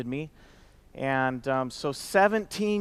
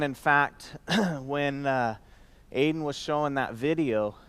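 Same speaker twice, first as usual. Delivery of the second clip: usual speaking voice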